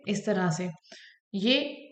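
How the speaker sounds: ordinary talk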